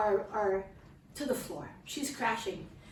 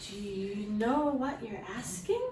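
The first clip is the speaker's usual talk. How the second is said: drawn-out, incredulous question; slow disbelief; slower than usual. Acted. Wife asked to bring her child home, slowly incredulous. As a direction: stretch the words out in disbelief. Overwhelmed wife challenging husband's request.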